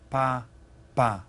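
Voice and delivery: Pa and pa split into separate syllables. Shown as a demonstration. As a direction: break the word into its syllables for the class. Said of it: "Listen to it".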